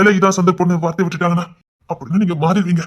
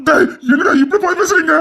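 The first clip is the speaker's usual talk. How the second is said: high, tearful outcry; wailing, desperate reproach; anguished protest; 9 semitones higher than usual. Acted. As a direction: cry out in hurt disbelief. Parent despairing at the others' words.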